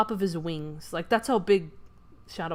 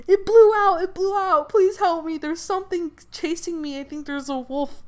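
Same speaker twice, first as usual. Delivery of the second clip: much higher and louder, voice pitched up in terror; panicked begging; 8 semitones higher, 5 dB louder than usual. Retold or imitated. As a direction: beg for help like a terrified kid, high and loud. Terrified kid begging Hagrid.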